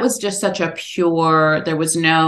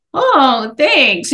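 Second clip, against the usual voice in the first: higher, louder, stiff little thanks; awkward thanks; 8 semitones higher, 5 dB louder than usual. Retold or imitated. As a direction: brush it off awkwardly. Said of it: "I was just so awkward".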